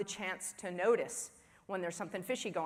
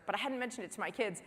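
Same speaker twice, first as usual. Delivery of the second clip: ordinary talk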